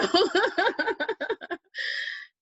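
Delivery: high, bright burst of laughter; amused laugh. Real, not acted. After mocking the roommate, an amused laugh. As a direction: laugh at your own cheek.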